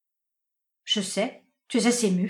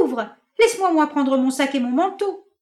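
shouting demand; 6 semitones higher, 6 dB louder than usual. Acted. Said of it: "criait-elle".

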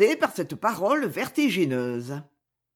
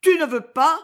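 higher, louder desperate plea; desperately; 8 semitones higher, 5 dB louder than usual. Acted. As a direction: plead with desperation. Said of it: "dit-elle désespérément". (désespérément, plus aiguë et plus forte)

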